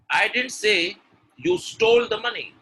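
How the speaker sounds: high, loud line peaking on "stole"; forceful, lifted delivery with weight on "stole"; stress on stole. Shown as a demonstration. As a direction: punch "stole". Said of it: "When I'm stressing on stole".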